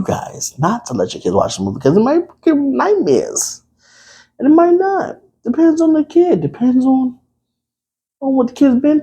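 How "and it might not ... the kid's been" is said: playful hedge. Acted. High-pitched aside.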